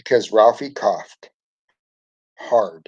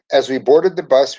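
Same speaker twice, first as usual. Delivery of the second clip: ordinary delivery, nothing marked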